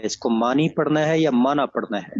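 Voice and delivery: first معنی as Urdu maani, second معنی as Arabic ma'na with open a; معنی پہلے "مانی" پھر "مَعْنیٰ"; contrasting two pronunciations. Shown as a demonstration. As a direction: set maani against ma'na, stressing the difference. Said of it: "میں نے عربی کا پوچھا ہے کہ اس کو کیا پڑھنا ہے".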